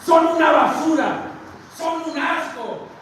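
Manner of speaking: high, loud mock condemnation; acted contempt. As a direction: imitate someone denouncing fellow believers, drawing out the insult. Te has referido a tus hermanos como basura. (condena fingida, a gritos)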